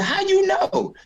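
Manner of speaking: shocked, can't believe it; disbelieving high squeak. Retold or imitated. Comic recounting a friend's call, squeaking high in disbelief. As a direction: voice jumps way up in disbelief. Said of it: "He said, I said".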